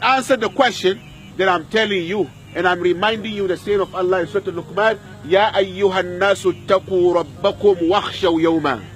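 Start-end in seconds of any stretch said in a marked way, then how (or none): none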